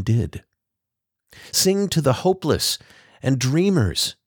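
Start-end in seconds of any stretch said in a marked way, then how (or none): 1.5-4.2 higher, pleading voice. A narrator's reading. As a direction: beg her sister to sing. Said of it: "Liza pleaded".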